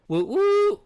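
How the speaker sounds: high, loud whooping cheer. Acted. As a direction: whoop like a fan at a rally. Cheering in reply.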